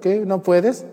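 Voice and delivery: acted snippy comeback; mock sassy refusal. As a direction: brush him off with put-on sass. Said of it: "Pero hay unas que no, te dicen".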